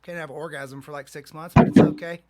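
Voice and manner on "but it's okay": loud, high laughing yell; laughing shout. Real, not acted. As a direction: burst out laughing loudly. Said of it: "Whoa, dude".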